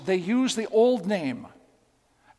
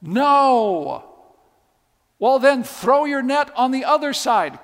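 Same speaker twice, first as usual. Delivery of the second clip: shouted reply; 4 semitones higher, 6 dB louder than usual. Acted. Shouted exchange across water.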